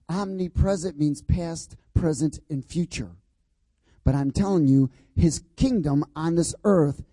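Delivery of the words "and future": ordinary talk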